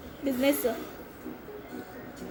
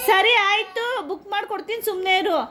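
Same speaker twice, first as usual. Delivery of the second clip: soothing son; 7 semitones higher than usual. Real, not acted. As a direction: gently hush him, voice up high.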